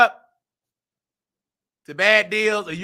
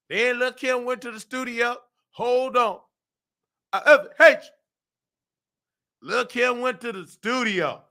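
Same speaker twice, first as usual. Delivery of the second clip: ordinary talk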